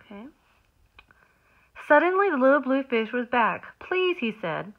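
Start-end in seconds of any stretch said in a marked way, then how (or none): 1.9-3.8 lively storybook narration; reading to children. A narrator's reading. Reads picture book aloud. 3.8-4.2 high, pleading little voice; pleading little fish. Acted.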